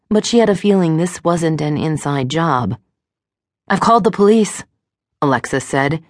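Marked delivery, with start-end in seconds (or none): 3.7-4.6 commanding. A narrator's reading. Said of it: "hoping she sounded more commanding".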